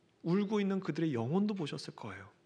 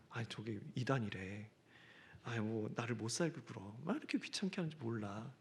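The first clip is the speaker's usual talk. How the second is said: muttered gossip; 6 semitones lower, 9 dB quieter than usual. Retold or imitated. Gossip retold.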